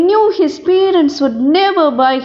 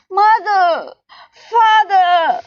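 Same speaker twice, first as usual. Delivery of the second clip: mild crying call; 4 semitones higher, slower than usual. A narrator's reading. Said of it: "he cried".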